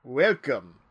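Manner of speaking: host's thick Romanian-style greeting; attempted thick accent. Host leering a welcome to guests, thick Romanian-sounding accent. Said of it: "That sounded Romanian".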